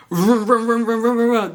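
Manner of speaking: mock engine vroom. Mocking speeding e-bikes.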